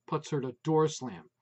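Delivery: usual speaking voice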